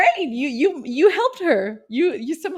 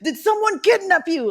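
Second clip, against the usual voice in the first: alarmed operator; 6 semitones higher than usual. Pretend. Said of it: "I can imagine the call".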